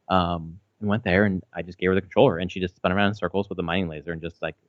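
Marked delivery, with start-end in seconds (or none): none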